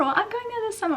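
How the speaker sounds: mock the holiday crowd in a high voice; high sing-along parroting of other people; mimicking everyone. Mimics everyone boasting about Mallorca.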